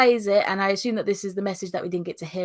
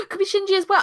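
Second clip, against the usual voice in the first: gasping realisation; 14 semitones higher, 4 dB louder, much faster than usual. Real, not acted. Sudden suspect idea.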